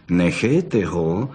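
low, slow growl; slow, low threatening snarl; growled warning. Acted. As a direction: growl it out like a cornered fox. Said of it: "zavrčela bystrouška".

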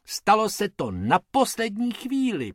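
usual speaking voice

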